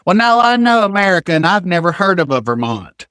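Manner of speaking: very high, insistent contradiction; imitated grandmother-in-law. Grandmother-in-law rejecting an American state, high and insistent. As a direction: mimic a relative who will not be told.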